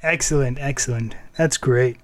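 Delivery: ordinary talk, nothing marked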